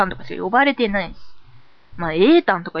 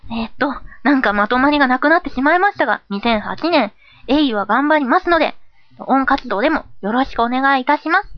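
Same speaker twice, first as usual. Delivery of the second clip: higher, lively presenter voice; polite closing greeting; 4 semitones higher than usual. Acted. Host persona closing the message, polite and higher. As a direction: wrap up politely with a lift.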